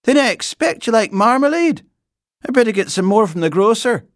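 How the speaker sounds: loud, high, bustling voice; decide it briskly in a high-pitched voice; brisk housekeeper. A narrator's reading. Housekeeper planning marmalade for the bear, brisk.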